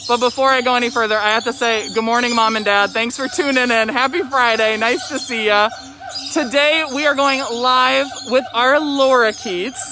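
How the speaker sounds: ordinary delivery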